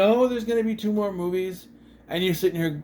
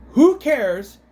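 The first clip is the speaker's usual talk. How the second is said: louder, higher retort at the screen; exasperated outburst; 8 semitones higher, 9 dB louder than usual. Retold or imitated. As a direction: throw it out loudly, fed up. Shouting back at the movie character.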